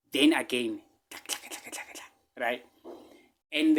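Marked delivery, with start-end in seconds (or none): 1.1-2.1 softly whispered clack sounds; whispered typing clacks. Retold or imitated. He mimics texting while pretending to be on WhatsApp, whispered clacks. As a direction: mimic thumbs tapping a phone, hushed.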